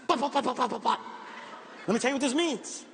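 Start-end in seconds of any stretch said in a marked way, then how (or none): 0.1-1.0 gorilla chest thump. Retold or imitated. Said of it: "He said".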